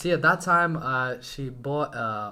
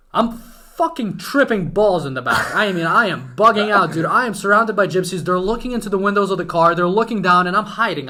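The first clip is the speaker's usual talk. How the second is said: loud, raised-pitch agitated storytelling; frantic retelling; 5 semitones higher, 9 dB louder than usual. Retold or imitated. Storyteller hiding from the crowd around the car, frantic. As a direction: relive the panic at full volume.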